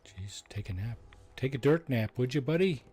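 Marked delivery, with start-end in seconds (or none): 1.3-2.8 taunting. Real, not acted. Taunting the ghoul while fighting.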